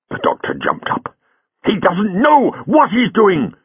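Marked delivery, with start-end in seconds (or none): 1.6-3.5 high, slow, emphatic outburst; furious, pitch soaring, every word driven; angry shout. Acted. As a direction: shout it in sudden anger. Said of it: "he shouted in sudden anger".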